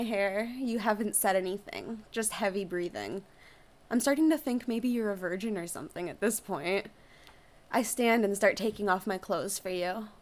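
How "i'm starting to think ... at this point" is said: plain reading voice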